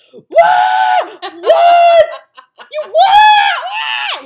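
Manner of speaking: imitated high-pitched shocked scream; screaming mom. Mom hearing of daughter's Columbia admission, screaming. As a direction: shriek it at full force, like a floored mother. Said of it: "screaming at the top of her lungs".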